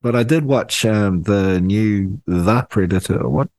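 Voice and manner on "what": normal talking voice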